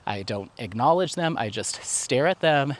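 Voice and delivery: ordinary talk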